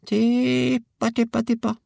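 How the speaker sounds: very high sing-out bird call; coaxing a hen. A narrator's reading. Georg lures the hen to the spoon, high coaxing.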